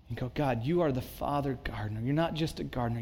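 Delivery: ordinary talk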